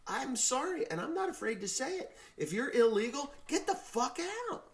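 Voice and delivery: high-pitched, climbing indignation; heated rant. Real, not acted. Man telling illegal immigrants to leave, heated high-pitched rant. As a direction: build to a high, indignant outburst.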